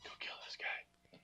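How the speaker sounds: hushed unvoiced whisper. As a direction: whisper it into his ear. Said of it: "does he whisper in his ear".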